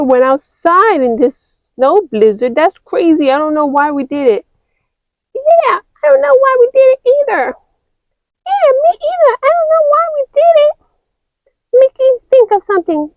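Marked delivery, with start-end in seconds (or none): 5.3-13.1 high, fast toy voice; high chirpy doll voice; high character voice. Acted. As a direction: agree quickly, high. High figure agrees.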